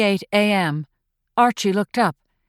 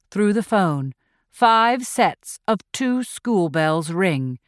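usual speaking voice

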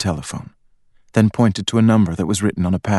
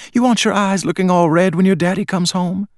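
coaxing mother; 11 semitones higher than usual. A narrator's reading. Mother coaxes crying daughter.

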